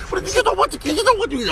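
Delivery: animal voice. Acted. One animal coaxing another to wrestle, high voice. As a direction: coax it high and sly.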